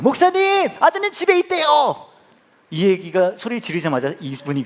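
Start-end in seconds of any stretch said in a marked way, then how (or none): 0.0-2.0 high, loud shout, slow on the news. Retold or imitated. Visitor calling out to the pastor that his son is home, loud high shout. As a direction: yell it across the office so the pastor hears. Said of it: "이분이 소리 지른 거예요".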